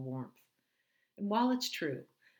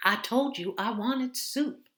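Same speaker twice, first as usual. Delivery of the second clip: put-on louder, higher, slow elderly voice; confused old mother; 8 semitones higher, 10 dB louder than usual. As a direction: play the muddled mother, slow.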